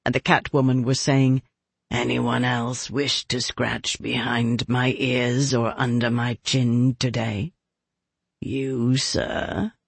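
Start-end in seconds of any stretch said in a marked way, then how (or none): 1.9-9.7 lower, softer, slow purring invitation; low catlike purr. Acted. Cat woman inviting the crowd.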